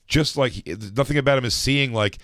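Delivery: normal talking voice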